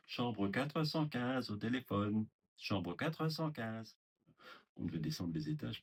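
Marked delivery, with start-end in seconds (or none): 0.1-4.0 slow, drawn-out call over the intercom; imitated announcer call. Receptionist calling a resident to the telephone, imitated drawn-out announcement. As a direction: mimic a receptionist paging a room, stretching the words.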